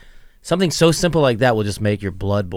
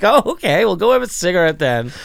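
much higher, louder sarcastic huff; mock sarcastic snap; 8 semitones higher, 6 dB louder than usual. Guest mimics host's sarcastic comeback, high mock huff. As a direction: throw it back with fake annoyance.

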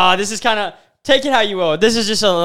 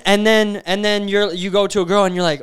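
normal talking voice

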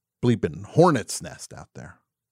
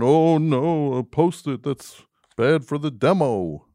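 mock corporate drone; 6 semitones higher, 4 dB louder, slower than usual. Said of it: "I don't know why I'm talking like this".

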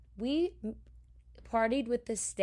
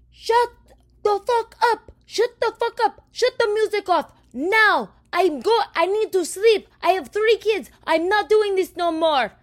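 screaming neighbor, much higher and louder; imitated neighbor yelling; 13 semitones higher, 10 dB louder than usual. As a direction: shriek it like a furious neighbor woken up. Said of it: "and says".

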